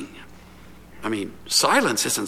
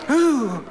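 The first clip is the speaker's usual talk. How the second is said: high, drawn-out mock ooh; a hammed-up cowed old man's ooh; old man sighing ooh at the lioness; 8 semitones higher than usual. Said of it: "this old guy is going".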